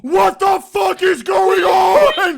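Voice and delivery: high, loud, drawn-out panicked yell; retold scream. Stoned friends at a con recalling confused screaming, loud slow yell. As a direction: scream it like you've lost the plot entirely. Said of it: "eventually started screaming".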